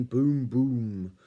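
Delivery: loud, booming sound word; booming footsteps. A narrator's reading. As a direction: boom it out like heavy feet. Imitates heavy approaching footsteps.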